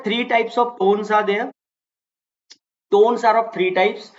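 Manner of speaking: very slow, word by word, as if dictating; slow, deliberate classroom dictation. Shown as a demonstration. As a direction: spell it out slowly for the class. Teacher dictates rule slowly.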